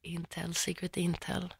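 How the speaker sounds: very hushed, confidential murmur; playfully secretive, barely audible; mock-secret aside. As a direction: drop right down as if leaking secret intel.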